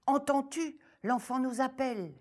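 high, quick, urgent hare voice; hurry, the child needs us; one hare alerting the other, urgent; alarmed hares. Acted. Hares alarmed hearing the call.

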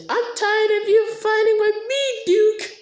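high-pitched character voice; Sasha. Acted. Sasha scolding Duke for fighting, high-pitched put-on voice. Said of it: "And Sasha says".

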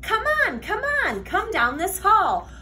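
ordinary delivery, nothing marked

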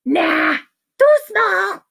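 high, loud rejection; dismissive doctor. Acted. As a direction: wave it off in a cartoonish voice. Doctor rejects needle again.

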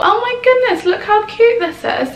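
gushing over a cute find; excited squeal. Real, not acted. Unpacking clothes, spots a cute top, high excited gush. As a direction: pitch jumps way up in delight.

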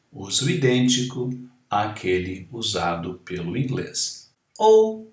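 ordinary talk, nothing marked

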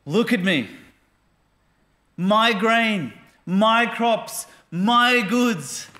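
boastful rich man. Acted. Preacher acting the rich fool admiring his barns, gloating.

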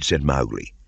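ordinary delivery

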